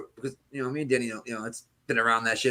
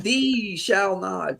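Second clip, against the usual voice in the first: high, very slow proclamation; mock curse; 8 semitones higher, much slower than usual. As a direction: draw it out like a mock-archaic curse. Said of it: "And cursed him and said".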